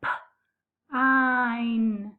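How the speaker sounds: pine split into P and ein, tapped slowly; tapped-out word. Shown as a demonstration. Teacher to young pupils, tapping out a word sound by sound. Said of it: "I'm gonna tap out pine".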